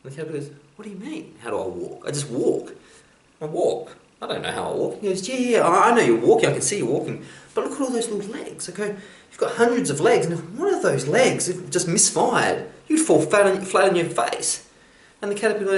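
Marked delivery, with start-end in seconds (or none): 0.8-5.0 softer, bewildered critter voice; a bug that never thought about walking; puzzled caterpillar. Acted. Caterpillar confused by the frog, soft and puzzled. 5.3-14.7 high, squeaky frog voice, much higher, full of wonder; a small frog gasping at all those legs; amazed frog. Acted. Frog marvelling at the caterpillar's hundred legs, very high and amazed.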